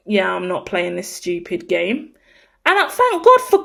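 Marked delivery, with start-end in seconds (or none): 0.1-2.1 low, much softer, unbothered mutter; bored dismissive. Retold or imitated. Kourtney refusing the dress-up game, low-key and dismissive. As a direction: shrug it off, barely bothering to speak. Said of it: "Kourtney was like".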